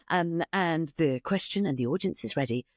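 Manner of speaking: usual speaking voice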